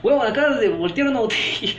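high, loud mocking scoff; sarcastic. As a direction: scoff at the fuss. Deflating the amazed crowd.

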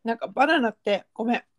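choking on a nut mid-sentence. Real, not acted. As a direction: talk while something is stuck in the throat. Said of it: "食べながら話すからそういうことなんねんで".

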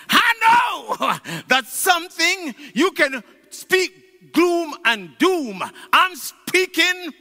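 building sermon voice, lifted and declaiming; rising preaching. Acted. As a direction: preach it up toward the crowd.

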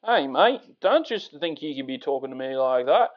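broad mock Aussie accent; Australian accent. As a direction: confront a mate in exaggerated Australian. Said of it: "down under".

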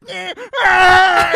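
hammed-up high, loud scream of pain; mock pained scream. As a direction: imitate a movie character screaming in agony. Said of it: "the character gets stabbed in the eye".

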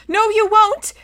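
put-on loud, high-pitched child's retort; high, loud, fed-up contradiction; exasperated girl. A narrator's reading. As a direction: snap back in a high girl's voice. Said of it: "said Scarlet with a huge sigh".

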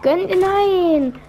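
high, drawn-out pleading cry; desperate plea. Real, not acted. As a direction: beg loud and high, stretching words. Teammate dies, desperate plea.

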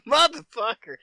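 high, loud, startled curse; shocked curse. Retold or imitated. Told the snake sat under his recliner, a loud shocked curse. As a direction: blurt it out in shock.

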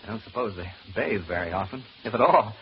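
ordinary talk, nothing marked

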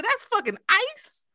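high, disbelieving cry; can't believe it's ice; incredulous question. Retold or imitated. Shocked at hail.

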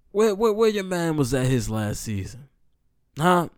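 high, loud heckling of a rival fan; loud teasing challenge. Retold or imitated. Fan ribbing Kobe fans in the dorm lounge, loud teasing challenge.

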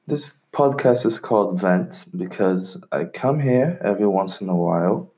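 usual speaking voice

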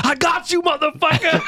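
very high, gloating mock yell; Tracy Morgan. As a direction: squeal the gotcha as an imitated comedian. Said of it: "Tracy Morgan has a very distinct voice".

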